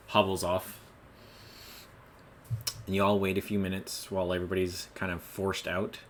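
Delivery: ordinary talk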